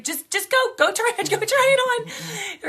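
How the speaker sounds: high-pitched imitation of pushy urging. Stranger shooing her to go try a dress, pushy urging.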